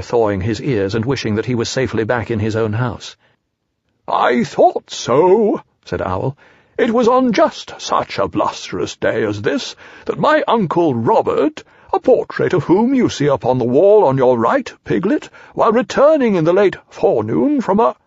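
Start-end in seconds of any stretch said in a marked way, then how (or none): 4.1-5.6 high, very drawn-out owl; slow, swooping storybook owl; Owl satisfied. Acted. 6.8-18.0 high, swooping owl reminiscing; self-important storybook owl; Owl holding forth. Acted.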